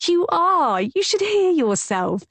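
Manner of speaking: high, giggling teasing; teasing him through laughter; spluttering laughter. A narrator's reading. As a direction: laugh the words out. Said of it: "I splutter with laughter".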